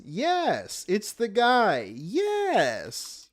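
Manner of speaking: high, slow, smug "yes, it's the guy"; imitating the fake-unbothered defense; mock sarcastic guy. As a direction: ham up a sarcastic shrug-off. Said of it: "It's the guy" defense".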